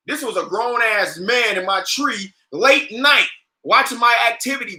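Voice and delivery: ordinary talk, nothing marked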